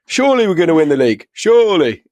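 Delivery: put-on high, loud drunken proclamation; [name]. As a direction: imitate a drunk fan boasting on TV. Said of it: "let this drunk man on".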